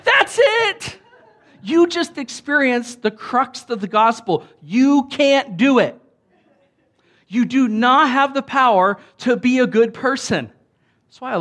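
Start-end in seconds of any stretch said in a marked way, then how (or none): 0.0-1.0 high, loud burst of joy; inner jubilation. Retold or imitated. Father retells his hidden joy during the talk, high loud cheer. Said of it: "on my insides, I'm like". 1.6-10.5 higher, louder hammered sermon point; preaching at full force. Acted. Pastor presses the gospel point on his congregation, full-force preaching.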